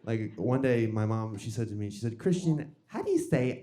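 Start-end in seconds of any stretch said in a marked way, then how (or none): 2.2-3.6 slow New York mom question; New York accent on "orange"; mom's New York accent. Retold or imitated. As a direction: imitate a New York mother, drawing words out. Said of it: "She has a New York accent".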